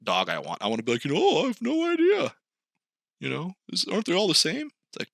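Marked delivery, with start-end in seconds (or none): 1.2-4.7 high clueless voice. Retold or imitated. Imagined clueless person.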